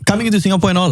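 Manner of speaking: ordinary delivery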